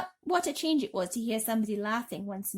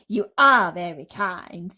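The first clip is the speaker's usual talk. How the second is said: slow, loud, lightly voiced centipede; a grand, drawn-out thank you; slow showy bug voice; 12 dB louder, slower than usual. Centipede character voice.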